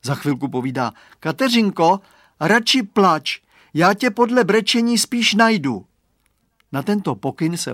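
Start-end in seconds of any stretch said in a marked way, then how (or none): 1.2-5.8 put-on boy calling out. A narrator's reading. Chlapec woła w mgle.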